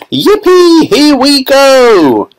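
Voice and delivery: high, loud, drawn-out whoop; joyful shout. A narrator's reading. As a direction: whoop it out as the ride takes off. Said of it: "shouted Grandpa Joe again".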